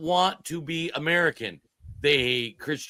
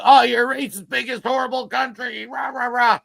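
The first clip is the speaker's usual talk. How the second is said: shrill mock scream, higher and louder; mocking high yell; 8 semitones higher, 5 dB louder than usual. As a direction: parody their outraged shouting, high and loud. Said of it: "I love when they scream".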